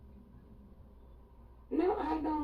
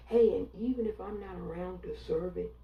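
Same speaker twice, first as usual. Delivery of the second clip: ordinary talk, nothing marked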